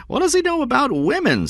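high, emphatic challenge falling on "women"; high-pitched indignation. A narrator's reading. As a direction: push the question up high in protest. Stone repeats the question more emphatically.

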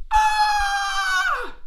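very high, loud, long shriek; terrified scream. Acted. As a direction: scream like a panicked army. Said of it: "and screamed".

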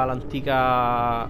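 ordinary talk

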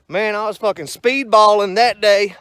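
shouted, rowdy brag; loud, half-mocking imitation of a hyped buddy; loud buddy boast. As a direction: brag it loud like a wound-up good ol' boy. Voicing buddy Offie's boast.

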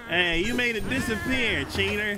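high, loud playful outrage; high excited accusation. Real, not acted. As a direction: squeal out the accusation.

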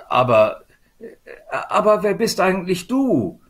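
hesitant start, then high puzzled question; astonished. A narrator's reading. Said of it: "betrachtete erstaunt ihre neue Gefährtin".

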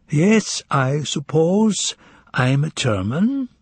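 high, slow, musing answer; pensive. A narrator's reading. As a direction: ponder it aloud, stretching the words in a high tune. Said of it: "suddenly grew pensive".

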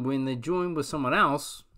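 ordinary delivery, nothing marked

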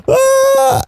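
sudden high shriek of shock; startled scream. Real, not acted. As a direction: yelp as the snowman hits. Dies to snowman in game.

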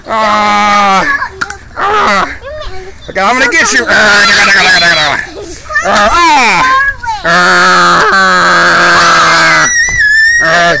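loud high repeated shrieks; playful shrieks. Pretend. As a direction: yelp at every dart hit. Repeated shrieks under dart fire.

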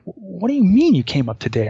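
loud, high, incredulous question; can't believe what he's hearing; disbelieving outburst. Retold or imitated. Climber to the casual runner, loud incredulous disbelief. Narrator retells his own disbelief.

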